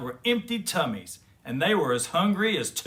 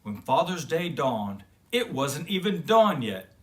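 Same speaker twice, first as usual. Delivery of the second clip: plain reading voice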